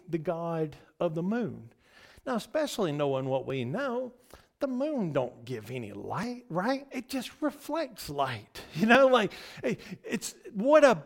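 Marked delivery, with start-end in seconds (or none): none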